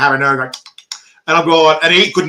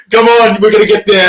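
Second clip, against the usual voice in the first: tag; 5 semitones higher, 6 dB louder than usual. Retold or imitated. Retells urging woman to bathroom.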